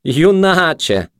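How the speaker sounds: high, drawn-out mocking echo; slow high taunt; jeering junkie. A narrator's reading. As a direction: stretch the word out in mockery. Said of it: "засміявся наркоман".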